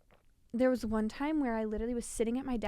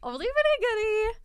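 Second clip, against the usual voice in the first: high, loud playful echo; 10 semitones higher, 7 dB louder than usual. Real, not acted. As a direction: sing it back playfully. Echoes friend exaggeratedly.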